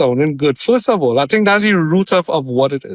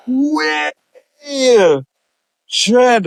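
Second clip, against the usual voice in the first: shocked; 9 semitones higher than usual. Retold or imitated. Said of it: "So I was like".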